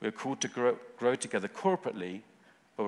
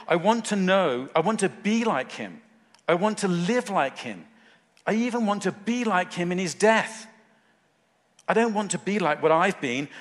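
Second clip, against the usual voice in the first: loud, high, fervent declaration; passionate; 7 semitones higher, 6 dB louder than usual. Acted. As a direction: preach it with impassioned longing, voice raised. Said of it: "can you hear the passion?".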